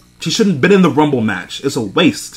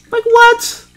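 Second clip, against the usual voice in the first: far higher, stunned squeak; high-pitched incredulous what; squeaky what; 4 dB louder than usual. Real, not acted. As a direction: yelp it in disbelief. Stunned at elimination.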